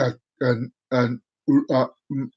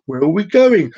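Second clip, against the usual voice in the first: normal talking voice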